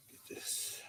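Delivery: whispered aside. Real, not acted. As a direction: lean in and whisper it. Aside to the chat.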